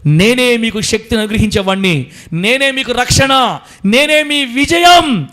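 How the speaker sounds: loud, slow, rising divine promise; God reassuring his children, loud and slow. Acted. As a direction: speak as God comforting his children, building. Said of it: "అని చెప్తున్నాడు దేవుడు మనతో".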